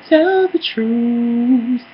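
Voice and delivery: high, slow, stretched-out "Tell the truth"; quoting Jamal. Retold or imitated. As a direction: draw each word out high like a diva. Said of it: "To quote Jamal".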